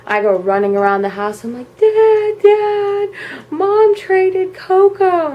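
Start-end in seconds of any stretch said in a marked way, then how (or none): 1.8-5.3 high, loud call to dad; retold drawn-out wail; wailing tattle, acted out. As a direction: cry out like an upset kid reporting a disaster. Acts child running, calling to dad.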